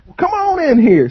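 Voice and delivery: high, swooping welcome; trailer-park uncle. Retold or imitated. Uncle inviting the two guys inside, high-pitched welcome. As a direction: wave them in with a high uncle holler. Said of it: "He's like".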